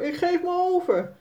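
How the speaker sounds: hammed-up giving up; mother playfully surrendering, calling out; called out. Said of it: "roept Sannes moeder".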